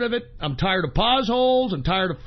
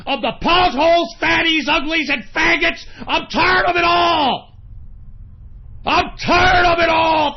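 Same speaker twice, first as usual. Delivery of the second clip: higher, louder raging yell; ranting host losing it on air; furious shouting; 8 semitones higher, 4 dB louder than usual. Acted. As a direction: scream it like you've had enough. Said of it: "I'm so fucking pissed off".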